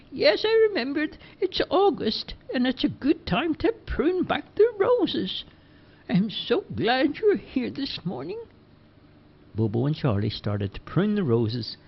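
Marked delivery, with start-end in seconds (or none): 0.1-8.4 put-on high, slow old lady voice; sweet and unhurried, pitched up; Missus Dockery. A narrator's reading. Missus Dockery chatting to the lads, high slow old lady voice. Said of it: "and then she said".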